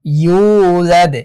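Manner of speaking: "ইউলাদ" with a long held "yuu" and bounced d; slow, drawn-out "ইউলাদ"; stretched vowel, qalqalah. Shown as a demonstration. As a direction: stretch the start of "ইউলাদ", echo its final d. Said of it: "ইউতে একাধিক টান হবে".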